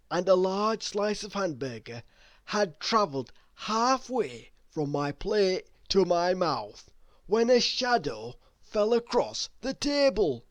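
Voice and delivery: plain reading voice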